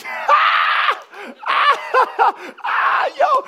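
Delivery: loud, very high shrieking laugh; imitated hysterical laughter. Man overcome by laughter in prayer, shrieked mock laughing. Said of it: "I'm laughing hysterically".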